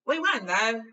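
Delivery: put-on loud gleeful boy; grinning. A narrator's reading. Ron cheering up Harry, grinning. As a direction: beam through the words. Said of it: "said Ron, a grinning".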